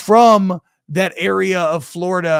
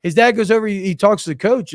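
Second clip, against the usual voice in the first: ordinary delivery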